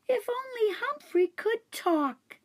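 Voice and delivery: put-on a confused little girl; young girl voice. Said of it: "she said".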